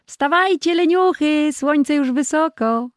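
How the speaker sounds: high, loud morning call; wake-up call. Acted. Calling the sleeping boys to get up, high and loud. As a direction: rouse lazy sleepers with a high shout.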